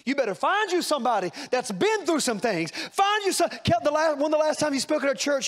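high, loud preaching cry; preaching at full force. Acted. Preacher urging the congregation to find tested mentors, loud and high. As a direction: lift it into a shouted sermon.

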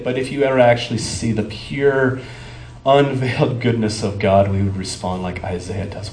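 usual speaking voice

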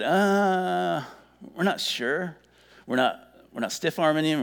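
unsure. Acted. Undecided listeners answering Paul, acted hesitant and unsure. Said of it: "We're not quite sure we're getting it".

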